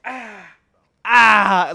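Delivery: mock wail as if shot; dramatic pained cry. Third grader kicked lightly in the arm, hammed-up pained cry. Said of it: "like he got shot".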